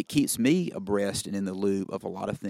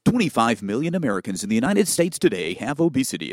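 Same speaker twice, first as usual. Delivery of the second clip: louder teleprompter read with sweeping pitch; overdone, quintessential broadcaster delivery; hammed-up broadcaster voice; 3 semitones higher, 5 dB louder than usual. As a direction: imitate a self-impressed TV announcer nailing the script. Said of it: "I start nailing this thing".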